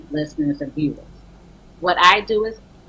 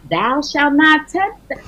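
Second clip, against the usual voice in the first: slow, higher, solemn biblical declaration; lofty King James voice; 7 semitones higher, 5 dB louder, slower than usual. Retold or imitated. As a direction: intone it like old scripture, slowly. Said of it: "He'll talk to me like this, not".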